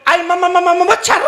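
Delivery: startled stammer at a mosquito; stammering bride. Acted. Stammering bride spots a mosquito, high and startled. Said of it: "दुल्हन एकदम बोली".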